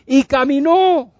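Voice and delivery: high, loud, stretched climax; slow exultant shout; triumphant proclamation. Acted. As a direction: proclaim the miracle with a long final vowel.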